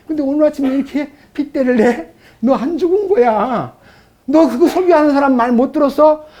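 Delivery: a taunting accuser, sneering at the believer; devil's accusing taunt. Acted. Devil taunting the believer, mock sneering voice. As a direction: needle him like the devil mocking.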